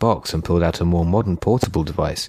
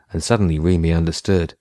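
usual speaking voice

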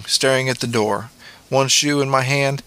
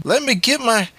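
high protesting plea; crying out; 11 semitones higher than usual. A narrator's reading. Boy begging stepmother for a moment, crying out. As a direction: cry it out high, as if being cut off. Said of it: "I cried".

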